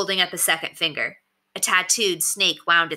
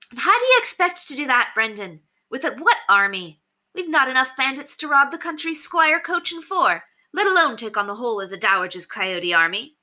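high-pitched woman's voice, pressing the challenge; challenging bandit woman; 7 semitones higher than usual. A narrator's reading. Bandit woman doubts her leader before the crowd, challenging. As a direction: confront the leader in a raised female pitch.

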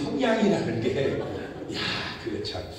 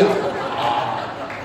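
usual speaking voice

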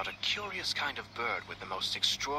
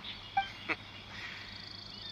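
soft breathy chuckle; 9 dB quieter than usual. Acted. Amused reply to guess.

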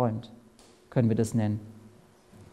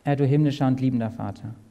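lower, reverent prayer voice; 5 semitones lower than usual. Real, not acted. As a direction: address God humbly. Leads closing prayer.

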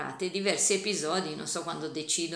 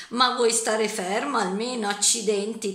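loud, higher angry roar; thundering; 4 semitones higher, 8 dB louder than usual. A narrator's reading. George chasing Harris, thundering loud and angry. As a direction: thunder it after him. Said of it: "tuonò George".

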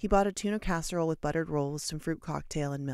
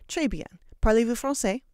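louder, higher French phrase; beaming French teacher; 7 semitones higher, 7 dB louder than usual. A narrator's reading. Pleased teacher asking Victor in French, louder. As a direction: beam it at the boy in French. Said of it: "teacher beamed and said".

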